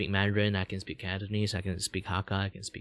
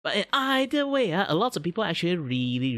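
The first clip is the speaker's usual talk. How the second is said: much higher, exasperated anime-style streamer voice; high VTuber voice; 9 semitones higher, 4 dB louder than usual. Acted. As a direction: VTuber scoffing at Roblox requests.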